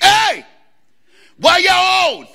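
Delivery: high, loud shout from the back seat; kid yelling. Retold or imitated. Eight-year-old boy yelling to parents in the front, loud high shout. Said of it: "So I yelled to the front seat".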